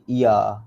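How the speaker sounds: "iya" as plain i-ya, undoubled; wrong single y. Shown as a demonstration. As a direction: say "iya" without holding the y. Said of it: "Salah lah iya tu".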